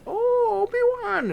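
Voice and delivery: very high, squeaky alien greeting; Jar Jar voice. Acted. Jar Jar Binks greeting Obi-Wan in Area fifty-one, very high goofy squeal.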